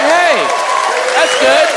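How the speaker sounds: high, bright mock cheer; high praising parent voice; excited parents. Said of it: "We're like".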